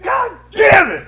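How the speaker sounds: drawn-out high furious yell; explode, stretching every word; losing it. Retold or imitated. Dad reenacts blowing up at son, shouting slowly. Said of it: "I fucking lose it".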